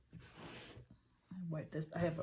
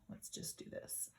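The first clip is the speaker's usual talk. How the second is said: whispered aside; 13 dB quieter than usual. Real, not acted. Murmuring to herself while crafting.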